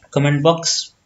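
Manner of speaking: ordinary talk, nothing marked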